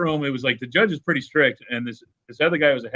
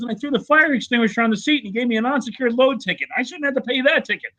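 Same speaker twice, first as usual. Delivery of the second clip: high-pitched mock indignant rant; mock outraged trucker; 12 semitones higher than usual. Trucker ranting at the judge, hammed-up outrage. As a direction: imitate a worked-up trucker ranting. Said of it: "he's all mad about it".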